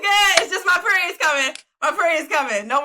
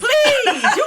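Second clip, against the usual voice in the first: laughing shout; 7 semitones higher than usual. Real, not acted. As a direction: burst out laughing on it. Mocking Eve, laughing.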